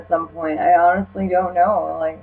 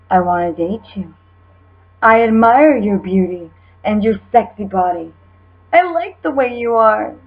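over-the-top dramatic; 4 semitones higher than usual. Acted. Reading a creepy admirer's message aloud, hammed-up lovesick voice. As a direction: gush every line like a soap-opera suitor. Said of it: "I'm just gonna do it over the top".

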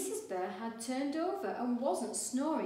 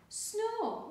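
high, loud mock snore; snoring noise; 12 semitones higher, 4 dB louder than usual. A narrator's reading. Missus Bear snoring in bed, high mock snore. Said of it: "went Missus Bear".

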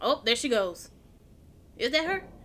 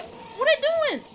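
usual speaking voice

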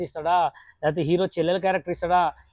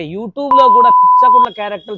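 loud exasperated burst; indignant outburst; 4 semitones higher, 13 dB louder than usual. Real, not acted. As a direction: blurt it out in disbelief. Indignant host to viewers.

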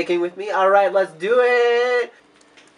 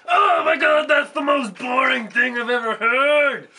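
exasperated shout; 5 semitones higher than usual. Acted. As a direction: yell it in comic disbelief. Puppet shouts at boring answer.

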